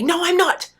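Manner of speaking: high, loud protest; a child snapping back that they are not mad; defiant kid denial. Retold or imitated. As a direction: insist like a cross kid who won't admit it. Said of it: "some kids will say".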